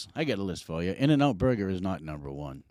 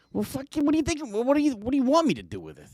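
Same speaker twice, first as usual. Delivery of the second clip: high-pitched, incredulous comeback to his wife; indignant retort; 3 dB louder, faster than usual. Retold or imitated. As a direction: throw it back at her, pitched way up. Acted retort to worried wife.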